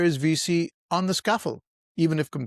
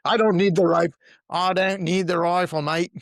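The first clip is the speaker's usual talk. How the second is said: put-on louder, higher New Zealand accent; 5 semitones higher, 5 dB louder than usual. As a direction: play a cocky Kiwi soldier, New Zealand accent. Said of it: "Was that your New Zealand accent?".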